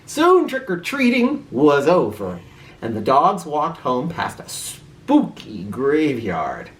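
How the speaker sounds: usual speaking voice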